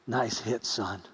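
soft, low fatherly murmur; quiet praise. Retold or imitated. Stranger dad quietly praising the batter, soft and low. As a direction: say it gently to the boy.

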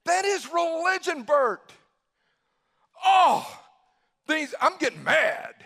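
loud, high-pitched acted exasperation; mock angry outburst. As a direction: blow up in mock frustration. Mock outburst of religious anger.

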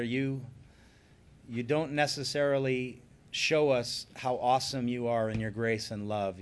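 ordinary delivery

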